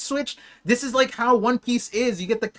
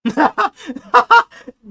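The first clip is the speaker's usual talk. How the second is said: high, loud burst of delighted laughter; 8 semitones higher, 11 dB louder than usual. Real, not acted. Streamer watching One Piece, laughing out loud in delight. Reacting to anime scene.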